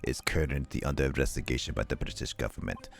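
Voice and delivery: low, measured prosecutor reading the charge. Acted. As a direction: lay out the accusation coolly.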